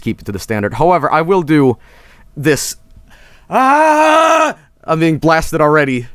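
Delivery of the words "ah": high, held "aah" yelp; startled yell. Real, not acted. Streamer getting blasted by the setup, startled high yell.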